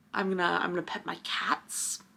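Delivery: soft whispered self-soothing. Real, not acted. Calming herself after a book, whispered. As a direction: whisper it to calm yourself down.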